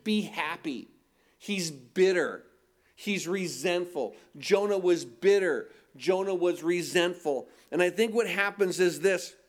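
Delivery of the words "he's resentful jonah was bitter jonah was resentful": ordinary talk, nothing marked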